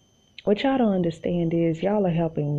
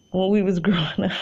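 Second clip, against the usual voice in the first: laughing; 2 semitones higher, much faster than usual. Real, not acted. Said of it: "laughs on line".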